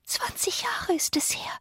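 put-on high, very soft child-ghost whisper; ghostly whisper. A narrator's reading. Child ghost answers from outside the window, whispering. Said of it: "flüsterte die Stimme".

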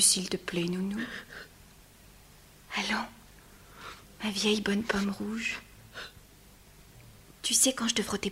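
soft, hushed comfort; tender whisper. Acted. Antigone gently comforts her weeping nurse in a whisper. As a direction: soothe her tears.

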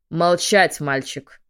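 loud angry bark; barked order. Acted. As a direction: bark it like a general. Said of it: "рявкнул генерал".

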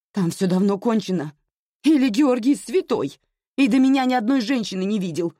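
high, loud and bitter; still shouting. Acted. Anya keeps shouting at her mother, bitterly sarcastic. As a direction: keep yelling, sarcastic. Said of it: "продолжала кричать Аня".

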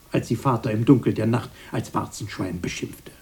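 normal talking voice

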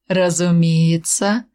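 slow, smiling assent; drawn-out courteous agreement; benevolent smile. A narrator's reading. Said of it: "благожелательно улыбнулся Тёмный".

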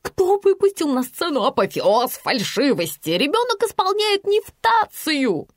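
high, outraged complaint; indignant scorn. A narrator's reading. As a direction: protest like an affronted expert. Scornful woman criticising the singer.

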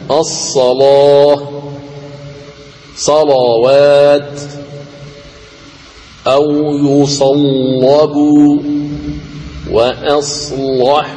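slow chanted الصلاة, صلوات, يصلب, وأصلح with thick L after ص; tajweed demo, velarized lam after صاد in صلوات; heavy lam. Shown as a demonstration. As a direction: recite each word drawn out, darkening the lam in الصلاة and وأصلح. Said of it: "تغلظ فيها اللام".

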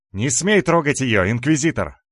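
громкий высокий окрик защитника; крикни во весь голос, защищая малышку; грозное восклицание. A narrator's reading. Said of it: "воскликнул я". (яростный запрещающий крик)

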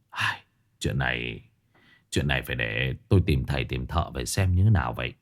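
hushed and low, goosebumps in his voice; uneasy husband. Acted. Old husband answers his wife quietly, shaken and low.

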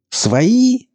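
very high, indignant squeal; breaking into a shriek. A narrator's reading. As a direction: screech the question in outrage. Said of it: "сорвавшись на визг".

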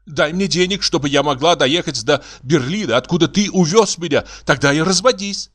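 high-pitched demanding woman; theatrical feminine retort; indignant wife. Acted.

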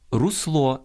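русло stressed on the final -ЛО; correct stress. Shown as a demonstration. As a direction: lift the last syllable of русло. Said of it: "а правильно русло наголошувати". (наголос на останньому складі: русло)

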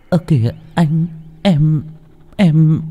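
put-on high, coy faltering girl; feeble protest. A narrator's reading. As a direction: weakly push him away.

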